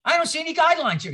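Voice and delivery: hammed-up angry professor rebuke; mad professor. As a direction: snap it out like an irritated teacher. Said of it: "He gets mad".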